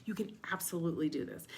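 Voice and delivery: soft, quick, kind reassurance; gentle encouraging voice. Retold or imitated. Coach voicing Gus's gentle nudge, soft and kind. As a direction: nudge them gently toward believing. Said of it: "gentle, gentle nudge, telling you".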